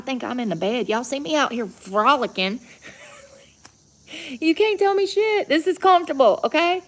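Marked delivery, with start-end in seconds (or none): 4.4-6.8 excited. Real, not acted. Frolicking in grass, boasting.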